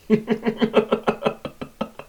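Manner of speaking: stuttering high laugh; wicked villain titter; sly giggle. Acted. Machine laughs at woman's danger, wicked giggle.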